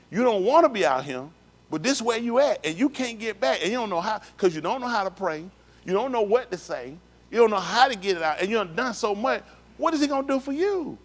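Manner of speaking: high-pitched preacher's plea, rising to the final question; impassioned preaching. Real, not acted. Preacher telling listeners they can't get back, impassioned. Preacher building to an anguished challenge.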